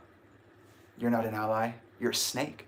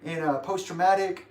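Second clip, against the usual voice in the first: opener addressing crowd; 6 semitones higher than usual. Retold or imitated. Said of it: "He was like".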